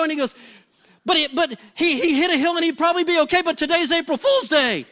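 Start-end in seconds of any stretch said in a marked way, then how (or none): 1.1-3.4 stammering, rushed mock panic, higher; David Griffin. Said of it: "gets panicky". 3.4-4.8 mock panic, blurting out the reveal; David Griffin. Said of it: "gets panicky".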